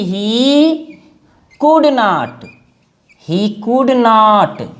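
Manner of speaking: very slow, word by word; slow dictation. Shown as a demonstration. Teacher repeating the phrase for notes, very slowly. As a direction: let each word sink in.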